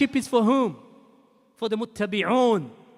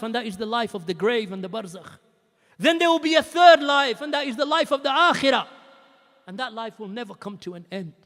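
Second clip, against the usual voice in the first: normal talking voice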